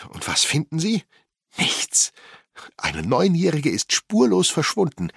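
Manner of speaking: agitated mother, pitch climbing into high outbursts; high, heated protest; indignant agitation. A narrator's reading. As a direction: build from indignant to frantic, punching key words. Distraught mother answers police question, indignant.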